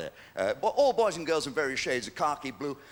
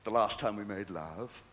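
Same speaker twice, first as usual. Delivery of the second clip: seductive phone line; 7 semitones lower than usual. Retold or imitated. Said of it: "I said".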